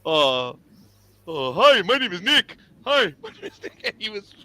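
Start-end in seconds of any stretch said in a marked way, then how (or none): 0.1-2.6 pointed introduction. Retold or imitated. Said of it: "I would always say". 2.9-3.8 laughing introduction. Retold or imitated. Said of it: "I would always say".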